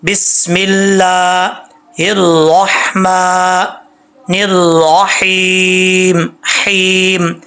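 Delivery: slow, drawn-out tilawat chant; measured Quranic recitation. Shown as a demonstration. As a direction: recite it slowly, stretching each syllable. Recites tasmiya in Quran style.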